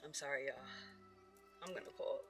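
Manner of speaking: usual speaking voice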